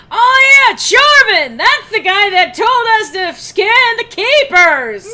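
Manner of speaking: high, loud, slow excited yell; excited shout. Retold or imitated. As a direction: yell it like a sudden realization. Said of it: "Kate is just like".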